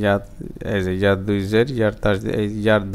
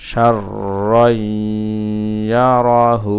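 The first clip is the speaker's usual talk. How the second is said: joined recitation; much faster than usual. Shown as a demonstration. Teacher putting the word back together, joined chant. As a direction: link it all, holding the long sounds.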